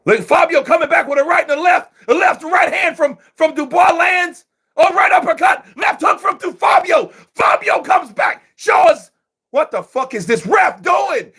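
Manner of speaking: excited shouting. Real, not acted. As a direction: call the flurry like you're ringside. Exchange erupts, ref interferes.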